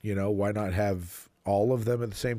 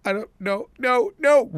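squeaky panicked no no no; high protesting whine; 13 semitones higher, 8 dB louder than usual. Retold or imitated. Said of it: "And he goes".

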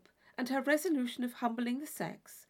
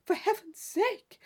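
slow, high and loud after a gasp; mock alarm, as if scandalized; gasping theatrical alarm; startled exclamation; 8 semitones higher, 5 dB louder than usual. Isabella startled by staring men.

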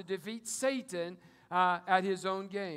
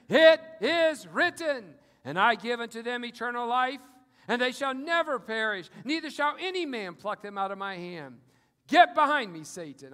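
loud, ringing scripture proclamation; bold rebuke; 3 semitones higher, 8 dB louder than usual. Acted. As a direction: declare it to Satan's face. Believer answering Satan loudly.

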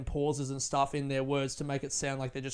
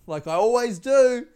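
laughing; 8 semitones higher, 9 dB louder than usual. Real, not acted. Laughing at own joke.

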